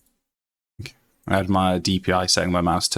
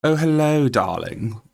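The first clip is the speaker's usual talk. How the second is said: high, lilting Queen's English greeting; posh Queen's English; 6 semitones higher than usual. Shown as a demonstration. Streamer demoing accents to chat, Queen's English. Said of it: "This is my Queen's English".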